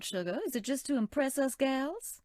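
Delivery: normal talking voice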